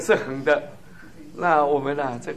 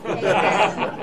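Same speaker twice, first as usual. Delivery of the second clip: short real chuckle; amused laugh; 2 semitones higher than usual. Real, not acted. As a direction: laugh at your own joke. Laughs at own punchline. (自己笑出声)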